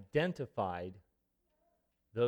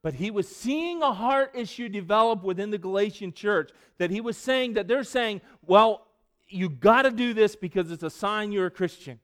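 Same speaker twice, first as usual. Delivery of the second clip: full-force preaching; 9 semitones higher, 12 dB louder than usual. Acted. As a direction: thunder Paul's warning at the congregation.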